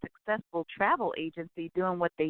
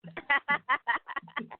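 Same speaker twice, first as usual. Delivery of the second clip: laugh; 8 semitones higher than usual. Real, not acted. Laughs about her age.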